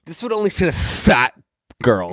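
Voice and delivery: high mock-snooty voice; mock snooty judgment; snobby shopper. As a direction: play the snob for laughs. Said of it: "joining the mock voice".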